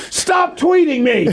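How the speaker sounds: high-pitched laughing quote. Retold or imitated. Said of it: "He's like".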